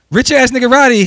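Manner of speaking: booming, high announcer drop voice; hype radio drop. Pretend. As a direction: shout the name like a radio DJ tag. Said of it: "You got a drop".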